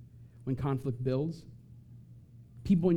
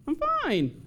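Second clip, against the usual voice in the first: "I'm fine" squeaked much higher in a put-on voice; mock high fine; 12 semitones higher than usual. Sulker faking cheer with a squeaky "I'm fine". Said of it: "we know you're lying when you say it".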